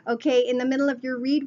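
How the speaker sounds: usual speaking voice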